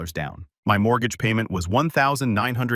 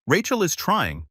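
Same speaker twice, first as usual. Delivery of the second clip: higher, slower protest; lightly acted insistence, drawn out; insistent; 8 semitones higher than usual. A narrator's reading. Mom defending her daughter to her son, mildly insistent. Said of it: "she'd insist".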